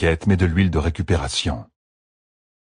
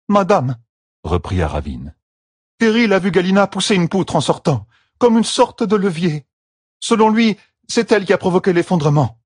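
Aravine the servant; 12 semitones higher than usual. A narrator's reading. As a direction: explain respectfully to her lady. Aravine reporting. (servante respectueuse qui rapporte)

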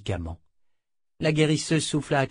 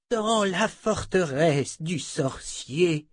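put-on very slow, strained, higher old man; struggling for breath; 6 semitones higher, much slower than usual. A narrator's reading. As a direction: force each word out, nearly spent. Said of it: "Zed dut lutter pour prendre une inspiration haletante". (voix haletante, à bout de souffle)

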